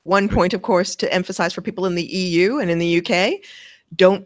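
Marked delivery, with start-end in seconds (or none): none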